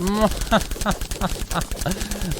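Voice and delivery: mock villain cackle in bursts; staccato scheming laugh; gloating evil laugh. Player gloats over super secret plan, villain laugh.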